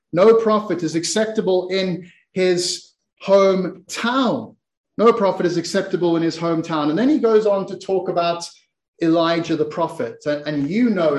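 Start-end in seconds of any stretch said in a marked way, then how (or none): 0.1-4.5 "No prophet is acceptable in his hometown" slowly, with pauses before the last words; slow, weighty declaration. Acted. Jesus answering the crowd in the synagogue, slow and weighty. Verse declaimed, then repeated quickly. 5.0-6.9 "No prophet is acceptable in his hometown" run through fast; quick restatement. Acted. Preacher repeating the verse before moving on, quickly.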